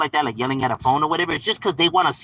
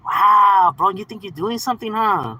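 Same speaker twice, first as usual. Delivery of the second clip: higher sarcastic wow, first word punched loud; mock impressed; 5 semitones higher, 4 dB louder than usual. Streamer answering people who get triggered, mock impressed. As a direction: feign being impressed, mockingly. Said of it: "it's just like".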